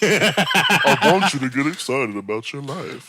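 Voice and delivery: slow, loud, hammed-up life-coach voice; Doctor Phil and Iyanla. Friend fusing two TV life coaches, mock motivational impression. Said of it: "Doctor Phil and Iyanla".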